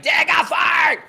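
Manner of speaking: put-on high, loud, slow sing-song yelling of a gloating kid; mock kid taunt. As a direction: shout it like a bratty son rubbing it in. Son taunting dad on sidelines.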